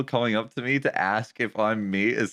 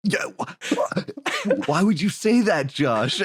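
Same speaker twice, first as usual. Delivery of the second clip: high, slow, breathy shocked friend; mock shocked; 7 semitones higher, slower than usual. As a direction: play it shocked and offended. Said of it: "And I was like".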